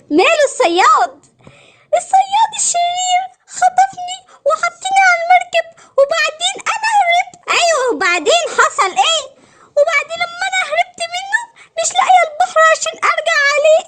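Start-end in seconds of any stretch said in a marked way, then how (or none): 2.0-7.3 high, whimpering character voice; tearful mermaid. Acted. Mermaid tells the princess of her kidnapping, tearful. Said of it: "ما تعيطيش تاني". 9.8-13.8 high, pretend-crying character voice; tearful mermaid. Lost mermaid tells the princess she can't get home, tearful. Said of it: "ما تعيطيش تاني".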